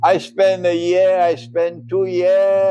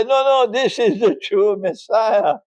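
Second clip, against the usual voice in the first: imitated objection; 7 semitones higher than usual.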